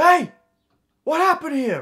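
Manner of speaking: high, loud confrontational holler; drunk stranger. Acted. Drunk stranger confronts a teenager by the road, hollering. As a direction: imitate an aggressive drunk calling out. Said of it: "he was clearly drunk for sure".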